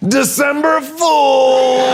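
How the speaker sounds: high, drawn-out shouted reveal; stretched triumphant prank shout; gleeful shout. Retold or imitated. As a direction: yell it like springing a prank. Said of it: "you gotta say it like that".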